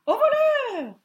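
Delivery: very high, loud alarm call; clattering stork. A narrator's reading. Stork catching the bear at her nest, very high loud cry. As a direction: shriek thief like a stork. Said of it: "claqueta un énorme oiseau".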